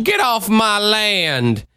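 slow high cowboy drawl; gruff old rancher, slow; grumpy rancher. Acted.

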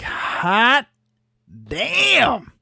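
high, drawn-out hot damn; mock awe. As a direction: gasp it out like you're awestruck. Retold awe at subs.

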